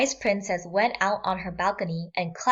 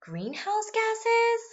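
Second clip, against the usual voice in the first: high, slow puzzled question; wondering princess; 14 semitones higher, much slower than usual. Acted. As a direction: draw it out in wonder. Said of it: "The princess wondered".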